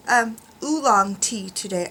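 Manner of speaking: ordinary talk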